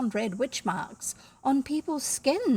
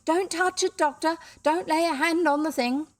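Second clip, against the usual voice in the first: urgent higher-pitched warning shout; 6 semitones higher than usual. A narrator's reading. Men at the gate warning the doctor, shouting. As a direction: call out a warning from afar. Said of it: "the men at the gate shouted".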